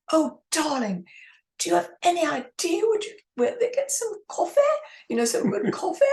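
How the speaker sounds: high, theatrical darling voice; grand old actress. Retold or imitated. Aging actress begging the writer for coffee, high and grand. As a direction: play the aging starlet asking for coffee. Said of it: "And she said".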